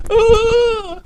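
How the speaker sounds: high shrill mock baby wail; adult imitating a startled baby; baby's startled cry. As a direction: squeal like a frightened toddler. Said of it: "starts to cry".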